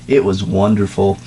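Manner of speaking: usual speaking voice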